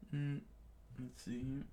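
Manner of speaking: ordinary delivery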